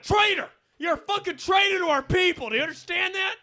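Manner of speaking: mock outraged yell, high and loud. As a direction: scream it at him like a traitor. Confronting sex shop man, yelling.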